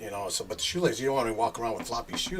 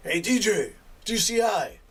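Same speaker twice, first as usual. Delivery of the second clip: higher-pitched imitated hail; retold call, pitched up; 8 semitones higher than usual. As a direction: get the DJ's attention. Prisoner calls to him again.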